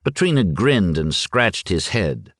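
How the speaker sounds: ordinary delivery, nothing marked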